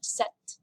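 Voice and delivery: "Sept" as "set", no p; silent P. Shown as a demonstration. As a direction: model "Sept" briefly with the P left out. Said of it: "the P is kind of silent".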